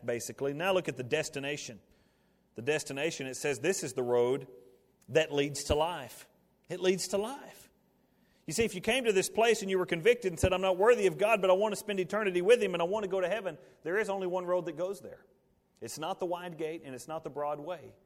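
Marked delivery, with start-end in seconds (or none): 5.1-15.2 high, loud, driving sermon voice; fervent preaching. Acted. Preacher urging the congregation to choose the cross, full force.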